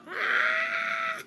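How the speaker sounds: very high, loud squeal of laughter; shrieking laugh. Real, not acted. Reader cracking up at the angry card, shrieking laugh. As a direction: burst out in a shrill cackle. Laughs at her own acted quote.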